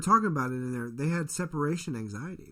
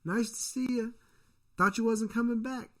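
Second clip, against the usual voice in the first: friendly greeters; 8 semitones higher than usual. Retold or imitated. Voicing students welcoming the twin back to campus, a light breezy greeting. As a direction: lightly play the classmates greeting him. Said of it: "people are just like".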